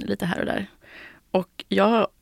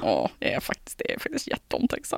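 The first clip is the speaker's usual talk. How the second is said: smug self-praise; 10 semitones lower, 7 dB quieter than usual. Retold or imitated. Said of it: "Jag bara".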